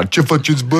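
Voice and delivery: lightly mimicked laddish greeting; rapper swagger. Host asking if he talks so in studio, mock swagger. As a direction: put on a touch of rapper swagger. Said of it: "Dar așa vorbește când intră în studio?".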